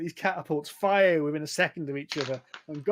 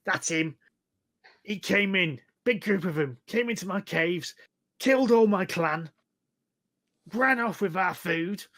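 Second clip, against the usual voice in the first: higher, aggrieved little goblin; pompous goblin; 4 semitones higher, 4 dB louder than usual. Acted. Goblin accuses the prisoner to the hobgoblin, pompous and aggrieved. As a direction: accuse like an indignant goblin boss. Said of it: "this little pompous looking goblin".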